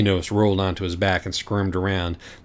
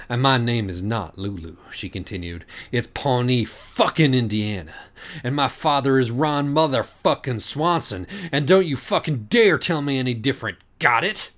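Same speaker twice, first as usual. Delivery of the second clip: building fury; 5 semitones higher, 3 dB louder than usual. Acted. Pawnee confronting the author.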